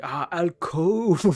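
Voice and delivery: long, loud, high "Ah, alcove"; slow delighted recognition. Retold or imitated. French person finally gets the word, slow loud delight. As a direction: stretch it out in sudden understanding. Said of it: "they were like".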